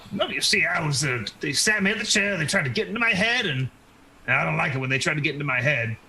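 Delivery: put-on fussy elderly character explaining herself; cantankerous old woman, flustered. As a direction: ramble on as a confused, put-upon crone. Game master plays old woman.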